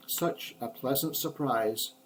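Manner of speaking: ordinary delivery, nothing marked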